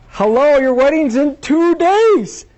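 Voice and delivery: high, slow and loud: can you believe this; incredulous disbelief. Retold or imitated. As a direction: stretch out the hello in exasperated disbelief. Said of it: "exactly that tone in her voice".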